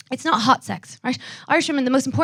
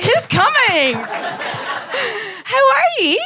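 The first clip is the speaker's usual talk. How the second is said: chirpy Irish greeting; 9 semitones higher, slower than usual. Retold or imitated. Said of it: "I was like".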